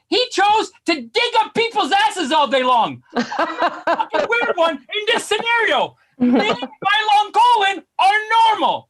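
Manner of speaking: high, drawn-out incredulous yell; exasperated rant. Acted. Comic railing at the doctor's chosen specialty, exasperated. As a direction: stretch each word in mock outrage.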